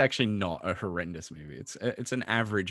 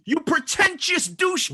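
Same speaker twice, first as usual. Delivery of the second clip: high, slow insulting yell; shouting; 4 dB louder than usual. Acted. Quoted movie insult.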